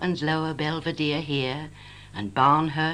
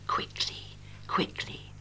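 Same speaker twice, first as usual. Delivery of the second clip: hushed, hurried whisper of urgency; urgent whisper; 7 dB quieter than usual. Acted. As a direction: whisper it as if time is running out. Urgent aside within recited poem.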